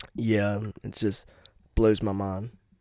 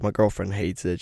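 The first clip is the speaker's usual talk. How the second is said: ordinary delivery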